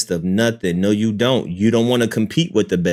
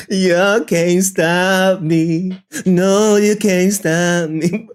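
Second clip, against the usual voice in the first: high, slow mocking sing-song; mock lyric; 7 semitones higher, slower than usual. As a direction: throw the line back at chat in a teasing croon. Mocking the lyric back at chat.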